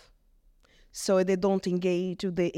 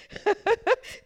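short laugh; 13 semitones higher, 3 dB louder than usual. Real, not acted. Laughs remembering vicar's tactic.

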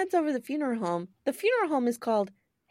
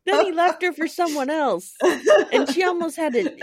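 laughing while talking; 5 semitones higher, 6 dB louder than usual. Real, not acted. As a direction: talk through laughter.

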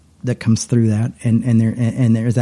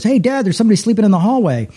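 put-on high, excited child's call to his dad; imitating a young son calling his dad; 8 semitones higher, faster than usual. Son calls out to dad about a stranger, imitated child voice.

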